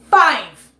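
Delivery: Five punched out in disbelief; loud, hammered single word. Real, not acted. Streamer hammering the number at chat, loud. Emphatic repeat.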